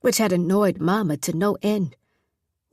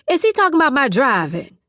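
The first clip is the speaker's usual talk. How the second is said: louder and higher, calling toward the phone; 5 semitones higher, 6 dB louder than usual. Acted. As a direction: raise your voice so the far end hears. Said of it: "Miss Lottie said loudly toward the phone".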